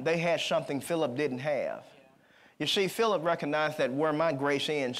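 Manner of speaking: ordinary talk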